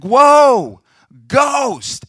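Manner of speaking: high, loud, drawn-out yell; hammed-up cry at seeing a ghost; mock terror. Disciples terrified by ghost.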